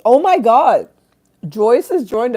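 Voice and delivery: loud, high burst of joy at a viewer arriving; delighted shout. Real, not acted. Streamer greeting a favourite viewer who just joined, delighted shout.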